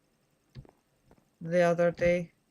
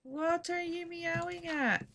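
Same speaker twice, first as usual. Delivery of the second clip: high, slow cooing at the cat; asking the cat, high and slow in a pet voice; 12 semitones higher, slower than usual. Real, not acted. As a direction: coo it up high at a meowing cat. Talking to the cat.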